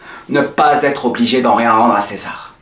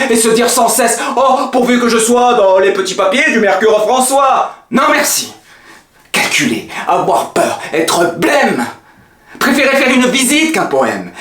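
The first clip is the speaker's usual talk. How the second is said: mounting tirade; 8 semitones higher than usual. Acted. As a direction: pitch climbing much higher, heated. Tirade of refusals escalating.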